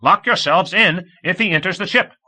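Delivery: shouting straight into the microphone; irritated shout. A narrator's reading. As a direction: bark the order in exasperation. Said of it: "Komov shouted with irritation".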